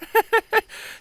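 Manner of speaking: high, forced little laugh hiding fear; a scared filly's high nervous chuckle. Acted. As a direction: laugh to cover rising terror. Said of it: "giving off a chuckle that did nothing to hide her building terror".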